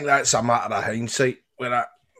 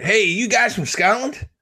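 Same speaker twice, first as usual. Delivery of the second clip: slow, higher American drawl; put-on American accent; 6 semitones higher, slower than usual. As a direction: pose as a Yank approaching strangers. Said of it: "I think I got the best Scottish accent ever".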